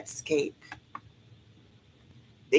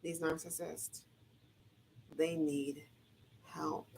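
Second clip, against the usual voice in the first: softer, near whisper; hushed; 15 dB quieter, slower than usual. Real, not acted. Vlogger telling viewers narcissists need help, hushed. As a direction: drop to a secret hush. Sudden hush after laughing.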